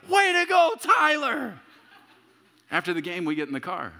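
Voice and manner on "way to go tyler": high, loud cheering shout; proud dad yelling again for the next assist. Retold or imitated. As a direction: cheer from the sidelines, much higher and louder. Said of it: "And I scream out".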